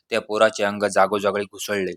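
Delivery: ordinary delivery, nothing marked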